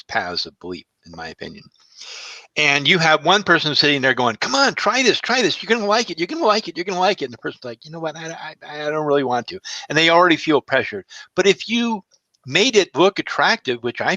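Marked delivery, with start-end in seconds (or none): 4.4-7.3 high, insistent pleading, repeating eagerly; pushy coaxing. Retold or imitated. One diner pressing another to try it, pushy coaxing. Acting a pushy person urging food. 7.8-9.6 reluctant. Retold or imitated. Reluctant person declining pushy offer.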